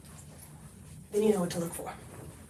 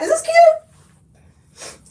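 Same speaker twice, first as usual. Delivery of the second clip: much higher, slow, laughing squeal; excited squeal; 5 dB louder, much slower than usual. Real, not acted. Asking viewers about the outfit, excited laughing squeal. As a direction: stretch it out, thrilled and giggly. Excited live try-on question.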